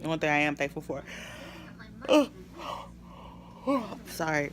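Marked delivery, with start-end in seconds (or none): 1.1-4.0 long shaky exhale; sigh. Real, not acted. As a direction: exhale it out, drained. Emotional pause before saying sorry.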